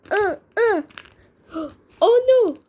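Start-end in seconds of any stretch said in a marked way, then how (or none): 1.5-2.6 sharp gasp, then a high loud oh no; gasp then cry. Pretend. Bird caught by the pigs, gasping high alarmed cry. Character startled in play.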